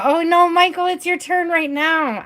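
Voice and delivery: high, urgent "oh no"; dismayed. Real, not acted. Host sees Michael missing his turn, high dismay.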